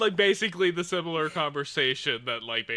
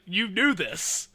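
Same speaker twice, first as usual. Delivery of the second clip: mock accusing; 7 semitones higher, 5 dB louder, slower than usual. Teasing friend over known show.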